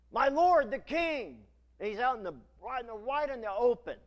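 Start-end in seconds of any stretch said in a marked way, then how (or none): 0.1-1.4 loud, high, slow call to the king; crying out. Acted. David calling out to King Saul: loud slow cry. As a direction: call it out across the valley. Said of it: "he cries out".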